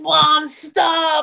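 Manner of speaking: high mock teen groan; a teen begging mom to quit; embarrassed daughter. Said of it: "she's like".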